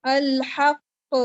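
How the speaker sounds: الحق slowed right down with a big loud bounce on the ق; heavily bounced qalqalah. Shown as a demonstration. Teacher repeating the word slowly to show the bounce. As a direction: stretch الحق and bounce the ق hard. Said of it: "بہت ہی زیادہ bounce کیا".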